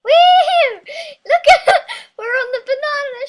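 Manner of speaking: high excited laughing yell; laughing shout. Real, not acted. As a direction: whoop it out mid-laugh. Riding the banana boat.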